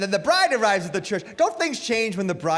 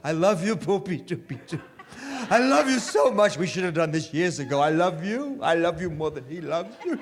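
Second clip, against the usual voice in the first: lovey-dovey newlywed. Retold or imitated. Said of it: "All you heard, the guys especially".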